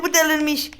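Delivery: normal talking voice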